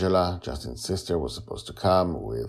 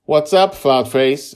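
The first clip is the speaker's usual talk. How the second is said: teasing sister; 10 semitones higher, 11 dB louder than usual. Acted.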